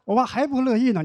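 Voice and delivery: ordinary talk, nothing marked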